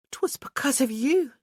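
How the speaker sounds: high gasped realisation; a breathless gasp of sudden understanding. Acted. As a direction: gasp it out as it dawns on her. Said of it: "she gasped".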